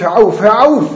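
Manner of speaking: loud, high urgent shouting; Rufen. A narrator's reading. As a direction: shout to make them stop at once. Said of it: "Felix ruft". (laut und dringlich gerufen)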